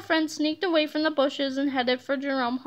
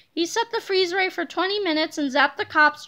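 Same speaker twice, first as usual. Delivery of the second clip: ordinary delivery, nothing marked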